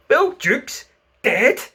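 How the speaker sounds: high, slow shocked exclamation; startled cry. A narrator's reading. Pirates hear Jukes is dead, high startled cry. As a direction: gasp it out in disbelief. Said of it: "cried the startled pirates".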